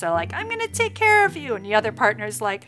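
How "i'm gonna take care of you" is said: high, louder sweet partner voice; mock sweet partner. Partner promising to care for them, high mock-sweet voice. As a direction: play the doting partner, pitched up.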